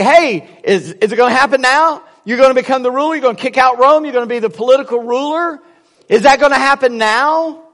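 high, loud, insistent questions; eager questioning. Retold or imitated. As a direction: press for an answer like impatient followers. Disciples eagerly pressing Jesus with questions.

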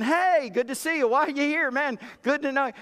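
eager greeter working the crowd; cheery greeting. Retold or imitated. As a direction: greet everyone like an old friend.

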